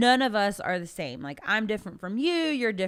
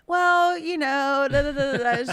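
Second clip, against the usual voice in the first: higher, louder blah-blah lecture; mock lecturing; 6 semitones higher, 5 dB louder than usual. Mocking April talking on and on. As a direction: brush off the lecture as babble.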